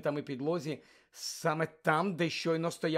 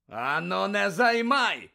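loud, slow, higher roar; 5 semitones higher, 7 dB louder, slower than usual. Acted. Moody roaring at Harry to leave it, loud bellow. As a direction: bellow it across the hall. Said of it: "заревів Муді".

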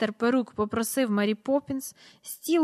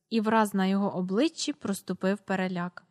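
usual speaking voice

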